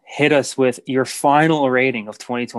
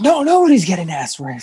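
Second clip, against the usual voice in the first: mock indignant reply; 7 semitones higher, 5 dB louder than usual. Mocking reply to Frank quote.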